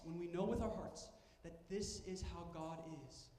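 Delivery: ordinary talk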